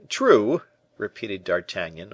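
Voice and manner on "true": high, loud, doubtful "true"; half convinced. A narrator's reading. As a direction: agree aloud while still doubting. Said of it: "only half convinced".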